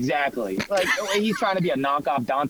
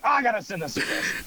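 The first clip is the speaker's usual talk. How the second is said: higher-pitched, eager mock exclamation; excited self-quote; 7 semitones higher than usual. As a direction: act out a sudden urge to share. Quoting own excited reaction to video.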